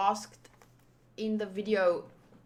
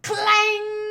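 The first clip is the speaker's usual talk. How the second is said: high, loud, ringing clang; held metallic clang; clang effect; 8 semitones higher, 8 dB louder than usual. Acted. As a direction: imitate clashing weapons, letting it ring. Voicing weapons clashing.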